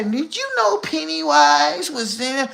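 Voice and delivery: a hint of a high, slow creepy voice; voicing the guy.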